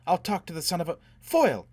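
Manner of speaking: high, loud, furious outburst; angry outburst. A narrator's reading. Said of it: "Gisbella burst out".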